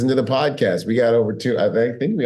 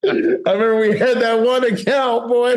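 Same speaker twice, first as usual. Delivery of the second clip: laughing recollection; 10 semitones higher than usual. Real, not acted. Laughing while recalling a client.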